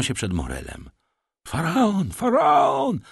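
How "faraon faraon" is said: high, jubilant shouts, climbing; crowd calling out. A narrator's reading. Crowd greets the returning ship, high excited calling. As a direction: cry the ship's name across the harbour. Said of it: "wołano zewsząd".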